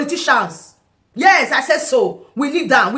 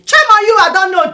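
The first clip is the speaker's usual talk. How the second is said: a sharp, much louder and higher rebuke; scornful shout; 8 semitones higher, 8 dB louder than usual. Real, not acted. Vlogger shouting scorn at unqualified leaders, loud and angry. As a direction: shame them loudly.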